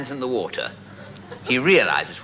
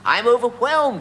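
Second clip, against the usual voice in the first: much higher, gushing overwhelmed cry; 12 semitones higher than usual. Retold or imitated. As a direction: burst out, overcome with delight. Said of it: "He was overwhelmed".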